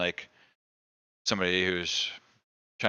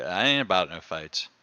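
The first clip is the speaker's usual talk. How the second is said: ordinary delivery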